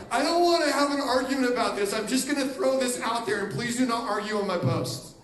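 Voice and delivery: loud mock online poster.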